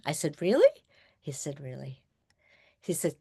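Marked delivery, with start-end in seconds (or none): 0.4-0.8 Really? high and louder with a sharp rise; Really? asked in bright disbelief; surprised question. Retold or imitated. Patient doubting the doctor's promise, Really? rising high. 1.6-2.0 Really. low and much softer with a falling tune; Really. said calm and certain, nearly hushed; quiet assurance. Retold or imitated. Doctor reassuring his patient, Really. low and soft.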